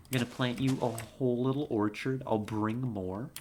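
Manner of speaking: ordinary delivery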